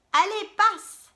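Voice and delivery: high, loud hurry-in call; eager welcome. Acted. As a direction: wave them in with a shout. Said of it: "dit le cerf".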